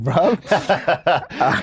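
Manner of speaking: high, put-on bratty teen voice; teen gangster voice. Boy whining to his mum about camp, in a put-on teen voice. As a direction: whine it like a sulky teen. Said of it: "And I'm just like".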